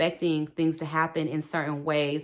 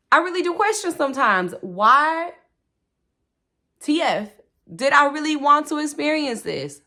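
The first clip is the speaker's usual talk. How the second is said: exasperated; 10 semitones higher, 7 dB louder than usual. Real, not acted.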